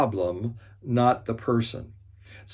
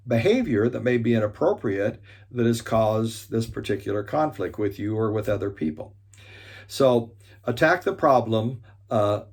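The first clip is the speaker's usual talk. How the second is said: normal talking voice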